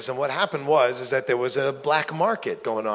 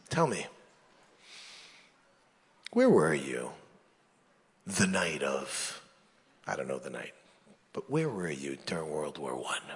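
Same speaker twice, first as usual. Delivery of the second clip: low, quiet menacing question; mob boss; 10 semitones lower, 7 dB quieter than usual. Acted.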